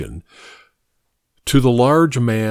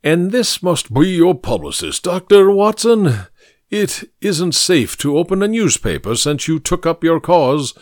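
higher, pompous orator's voice; pompous senator oratory; 5 semitones higher than usual. Acted. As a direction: lecture like a self-important senator. Mycroft voiced, pompous lecturing.

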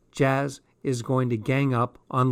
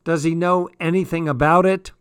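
loud, higher shout up the stairs; raised, carrying voice; yelling back; 4 semitones higher, 5 dB louder than usual. A narrator's reading. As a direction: yell a long message to someone upstairs. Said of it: "I heard Jazz yell back".

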